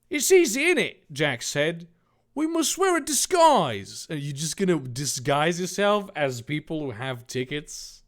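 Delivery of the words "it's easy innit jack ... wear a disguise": much higher, loud mock Cockney villain. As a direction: boast the evil plan in Cockney. Jack Cockney voice.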